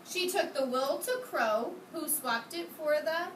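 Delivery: usual speaking voice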